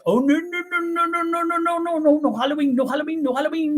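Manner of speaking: high, fussy, rapid-fire mock refusal; scandalized churchgoers. Church folks in College Station forbidding Halloween, high and scandalized. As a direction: play shocked church ladies waving it off. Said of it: "are like".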